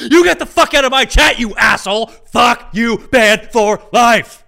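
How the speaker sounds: hammed-up screaming tirade, slowing on the final verdict; explosive, over-the-top angry shouting; furious mock shout. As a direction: yell it at full volume, high-pitched. Mock furious banning of chat user.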